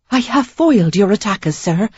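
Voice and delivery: proud and proper, a touch brighter; prim young lady. A narrator's reading. Sarah boasts to wounded stranger.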